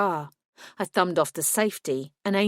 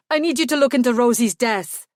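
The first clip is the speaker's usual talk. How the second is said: a louder, higher outburst; burst out; 5 semitones higher, 6 dB louder than usual. A narrator's reading. Werewolf begging the detective to investigate, louder outburst. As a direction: blurt it out, pushing hard. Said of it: "he burst out".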